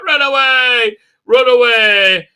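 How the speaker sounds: loud, high hammed-up cry; mock panic. Mocking Ryan and Graham fleeing spiders, loud panic. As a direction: yell it like fleeing in terror.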